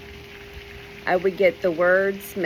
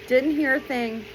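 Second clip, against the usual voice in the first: ordinary talk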